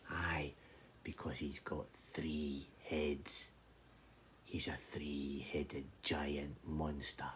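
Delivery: slow, low whispered secret. Acted. Shepherd whispers about the three-headed giant, slow and low. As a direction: breathe the secret slowly.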